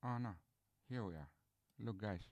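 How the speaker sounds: ordinary delivery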